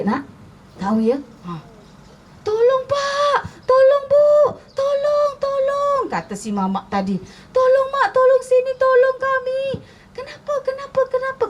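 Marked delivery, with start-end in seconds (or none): 2.4-6.0 very high, acted screams for help; screaming for help. A narrator's reading. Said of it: "mamak dia terjerit-jerit". 7.5-9.6 very high, louder acted screams; screaming for help. A narrator's reading. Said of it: "mamak dia terjerit-jerit". 10.2-11.4 high-pitched, startled questioning; alarmed villagers. A narrator's reading. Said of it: "kata bapak-bapak".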